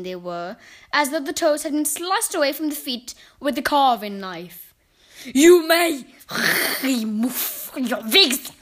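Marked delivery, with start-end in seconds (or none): 5.2-8.5 loud, snarled, drawn-out command; harsh guttural foreign-accented witch; Grand High Witch. A narrator's reading. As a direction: snarl it with a crackling rolled R. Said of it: "snarled the Grand High Witch".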